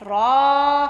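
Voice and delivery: "रा" drawn out on one held note; lengthened "raa". Shown as a demonstration. Teacher shows that alif makes it long, drawn-out "रा". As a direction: hold the vowel of "रा" long. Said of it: "आपने उसको लंबा करना है".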